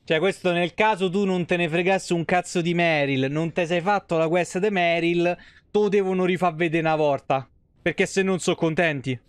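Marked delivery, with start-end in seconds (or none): none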